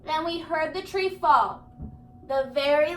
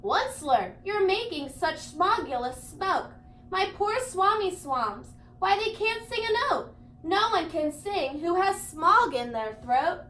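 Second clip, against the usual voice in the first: a lightly voiced cross creature scolding; a light touch of a grumpy forest guardian complaining; Lorax voice; 4 semitones higher than usual. The Lorax scolds the Onceler about smog, mildly cross.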